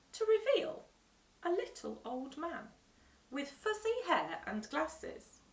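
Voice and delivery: usual speaking voice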